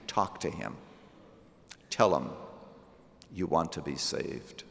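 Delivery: earnest pastoral prayer, softening to a hushed amen; closing prayer. Acted.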